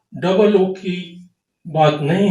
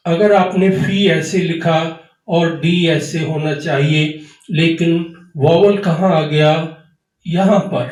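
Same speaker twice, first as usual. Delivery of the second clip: ordinary talk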